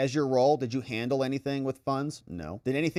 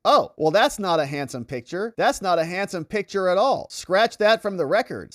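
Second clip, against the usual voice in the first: hammed-up backpedalling, pitched higher; flustered protest; 4 semitones higher, 4 dB louder than usual. Witness taking back his remark about the picture, flustered protest. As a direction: retract it in mock indignation.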